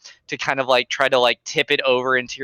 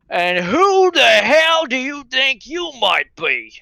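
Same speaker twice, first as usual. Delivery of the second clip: put-on slow, high, loud slurred drunkard; 11 semitones higher, 5 dB louder, slower than usual. GM voices drunk storyteller challenging newcomer, slow slurred. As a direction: drunk patron sneering at the stranger, slurred. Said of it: "in kind of a slurred tone".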